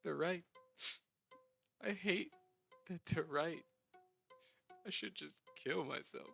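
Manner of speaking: crying. Acted. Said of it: "through his crying and sobs".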